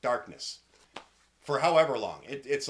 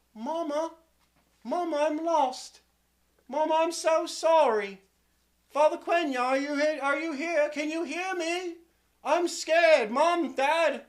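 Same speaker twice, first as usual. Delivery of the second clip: put-on high-pitched frightened boy calling out; small boy's loud, high cries; scared lost child; 7 dB louder than usual. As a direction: call for your parents, growing louder and more afraid. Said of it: "he starts to get scared".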